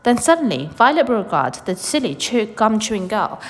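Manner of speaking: ordinary delivery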